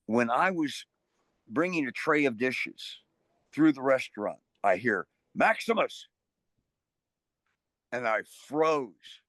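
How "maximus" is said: mock call, pitched high; retold call-out. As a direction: call the name out high, without really shouting. Name heard called across restaurant.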